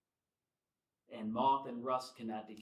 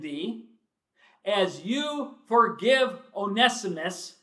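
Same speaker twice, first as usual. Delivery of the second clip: loud, higher, drawn-out pleading; preaching at full force; 5 semitones higher, 10 dB louder, slower than usual. Acted. Preacher voicing Paul begging Philemon for joy, loud and pleading. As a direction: hammer home Paul's plea. Paraphrasing Paul's plea to Philemon.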